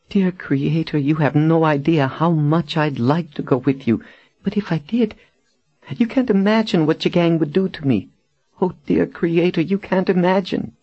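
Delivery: very high-pitched, anguished pleading; tearful dread. A narrator's reading. Captive tells her rescuer she cannot go, tearful dread. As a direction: plead high, on the edge of tears. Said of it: "Her eyes flooded with tears".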